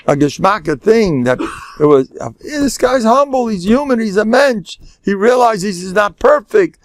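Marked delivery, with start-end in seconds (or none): 2.1-6.8 high, lilting praise; admiring onlooker. Acted. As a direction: voice an impressed bystander, pitched well up. Voicing admirer of humble man.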